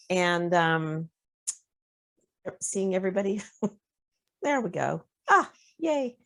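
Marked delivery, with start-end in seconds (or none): none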